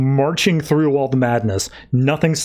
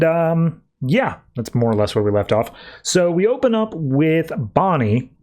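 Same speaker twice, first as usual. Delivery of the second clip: ordinary delivery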